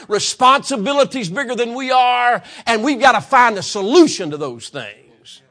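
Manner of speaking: loud, high, impassioned preaching; full-force preaching. Acted. Preacher rousing the church, full-force impassioned preaching. Preacher driving his point home.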